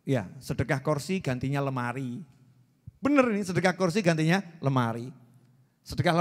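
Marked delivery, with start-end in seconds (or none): none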